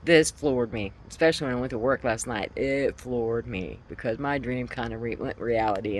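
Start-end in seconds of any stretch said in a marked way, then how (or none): none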